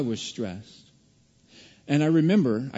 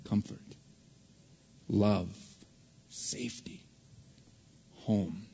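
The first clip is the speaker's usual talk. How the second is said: soft, whispered words of comfort; 5 semitones lower, 9 dB quieter than usual. Real, not acted. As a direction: breathe the words like a secret. Tender list of comfort.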